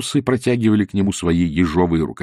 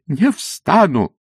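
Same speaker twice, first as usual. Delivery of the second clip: stubborn refusal; 7 semitones higher, 3 dB louder, much slower than usual. Acted. Priest refuses again.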